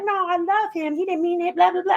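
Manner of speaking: mock the girls defending him; mimicking excuses. Rapper imitating girls who forgave their abusers, mocking.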